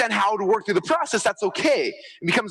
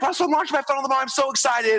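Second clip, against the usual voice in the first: giddy, higher pitched client quote; excited client; 8 semitones higher than usual. Retold or imitated. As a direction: gush about tomorrow's launch. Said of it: "they're like".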